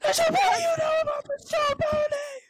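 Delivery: over-the-top fake sob; hammed-up wail. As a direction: wail it like a melodramatic victim. Mock dramatic retelling.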